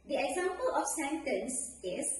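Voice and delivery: normal talking voice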